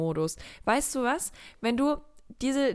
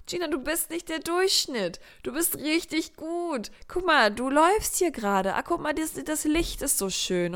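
much higher, bright encouraging cheer; an upbeat pep voice, higher throughout; cheering inner voice; 6 semitones higher than usual. Retold or imitated. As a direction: hype yourself up like your own coach. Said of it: "in meinem Kopf war immer".

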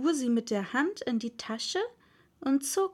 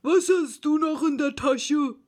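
loud, higher-pitched questioning; Dodo; 4 semitones higher, 6 dB louder than usual. A narrator's reading. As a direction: question her with loud self-importance. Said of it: "fuhr er zu Alice gewandt fort".

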